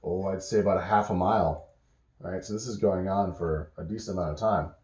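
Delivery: plain reading voice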